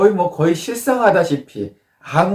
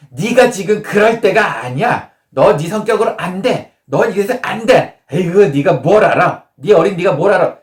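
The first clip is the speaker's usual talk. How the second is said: mock-angry parent scolding, pitched up; 4 semitones higher, 4 dB louder than usual. Parent rebuking a child who came for advice, angry scolding. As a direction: scold like an exasperated parent, higher and forceful. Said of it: "어머니가 화를 내면서".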